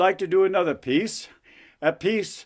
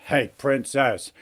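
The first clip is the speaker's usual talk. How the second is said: wisecracking frog; 3 semitones lower than usual. Acted. Frog prince answers princess's complaint, wisecracking frog voice. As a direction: shrug it off as a cocky frog.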